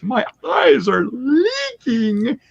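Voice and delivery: high, slow mock-tearful whine; mock teary. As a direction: play at crying like a softened Grinch. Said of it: "I felt like the Grinch".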